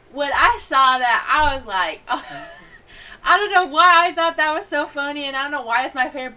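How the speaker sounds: high, loud laughing through the words; can't stop laughing telling it. Real, not acted. Laughing as she tells viewers her favorite part.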